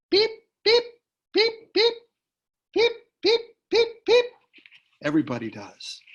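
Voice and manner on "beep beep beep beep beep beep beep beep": high, loud squeaky beep beep; high piping beeps. Shown as a demonstration. Reader showing the mouse folk's piping, high beeps. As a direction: pipe it out high like a tiny mouse. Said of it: "We pipe like this".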